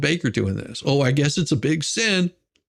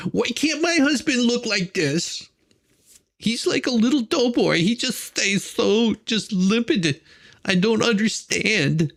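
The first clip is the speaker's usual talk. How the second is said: mock frustrated wife; 9 semitones higher than usual. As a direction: whine like a wife who wishes her husband looked like this. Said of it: "She's probably going".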